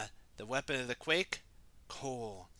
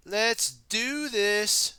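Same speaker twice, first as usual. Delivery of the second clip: slow, loud rally cry; rallying call; 8 semitones higher, 8 dB louder, much slower than usual. Acted. Cole rallying the ninjas to fight, slow loud call. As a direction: stretch out the rally for the team. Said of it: "said Cole".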